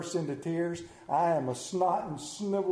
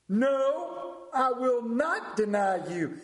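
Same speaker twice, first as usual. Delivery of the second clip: higher, drawn-out emphatic refusal; loud, obnoxious Peter; 6 semitones higher, much slower than usual. Acted. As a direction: play a brash disciple insisting, pitch raised. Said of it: "Peter was loud. He was obnoxious".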